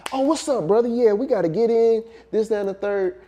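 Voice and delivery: high-pitched mock industry schmoozer; fake friendly greeting. Musician mocking fake club acquaintances, high phony greeting. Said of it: "I hate the fakeness of music".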